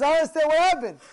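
very high, full-volume retort; acted shout. Rabbi repeats his answer to gabbais, shouting. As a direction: shout the reply over everyone. Repeats reply after being interrupted.

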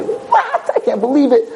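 high squealing mock disbelief; freaking out. Guy invited to dinner by a beautiful model, freaking out. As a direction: squeal it like he can't believe his luck. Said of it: "He's freaking out, he's going crazy".

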